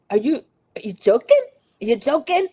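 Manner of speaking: loud, high, mock-incredulous question; shocked disbelief. Betrayed contestant asking Rob if he's joking, shocked. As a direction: imitate stunned disbelief, rising sharply at the end. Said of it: "like, four different emotions".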